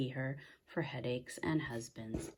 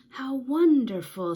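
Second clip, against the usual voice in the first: much higher, louder cry of wonder; amazed delight; 11 semitones higher, 12 dB louder than usual. A narrator's reading. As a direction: burst out in amazement. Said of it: "said Big Anthony".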